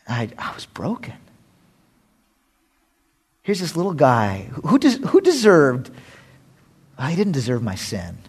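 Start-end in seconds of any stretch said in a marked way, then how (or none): none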